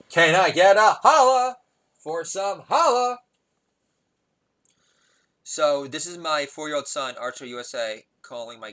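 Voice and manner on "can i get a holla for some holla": high, loud, drawn-out holler; stretched show-off call-out; hollered catchphrase. Acted. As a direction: belt it slowly to the crowd. Repeats hammed-up catchphrase.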